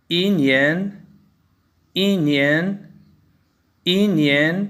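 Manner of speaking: इनियन said as yì-nián, ई falling then नियन rising; इनियन: fall on ई, lift on नियन; इनियन in Mandarin tones, fourth tone then second; Chinese yinian with tone change. Shown as a demonstration. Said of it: "ई का first tone बोलते वक़्त fourth tone में".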